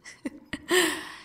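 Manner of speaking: high, creepy little giggle; a gleeful villain giggle. Acted. Villain laughing at captive.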